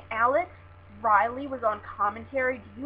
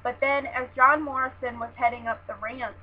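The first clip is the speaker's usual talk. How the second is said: ordinary talk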